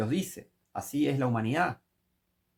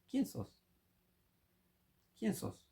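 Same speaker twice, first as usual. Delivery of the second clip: scornful question; 7 semitones higher, 10 dB quieter, slower than usual. Acted. Scornful rhetorical rebuke.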